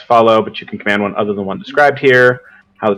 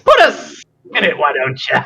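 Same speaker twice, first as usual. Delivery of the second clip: a touch of character voice, starting much higher; Yuge's taunt; 3 semitones higher than usual. Acted. Game master as Yuge jeers at the party, taunting. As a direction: pitch leaps up high, then drops back.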